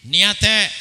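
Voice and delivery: loud call for an answer; preacher's challenge. Real, not acted. Preacher quizzing the congregation on prayer, loud call-out. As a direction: demand a response from the crowd.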